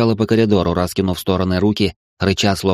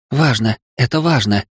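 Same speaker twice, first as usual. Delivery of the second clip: ordinary delivery, nothing marked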